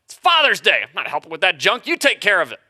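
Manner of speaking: high, loud comic outrage; hammed-up protest to the wife; mock indignant retort. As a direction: play the affronted husband, voice jumping up.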